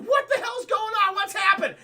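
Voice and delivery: high, loud mock alarm; panicked wife. Wife walking in on the attack, mock panicked cry.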